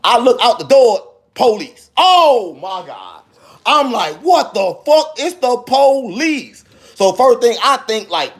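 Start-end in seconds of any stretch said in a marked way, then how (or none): 2.0-3.2 dismayed realization. Retold or imitated. Man seeing police outside his door, dismayed realization. 4.2-6.6 high-pitched, drawn-out retold shock; stunned disbelief. Man discovering the police are at the door, stunned disbelief.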